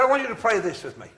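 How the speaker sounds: high, loud, quick invitation; calling out. Retold or imitated. Preacher inviting packed church to pray, loud call. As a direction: project the invitation over a packed room.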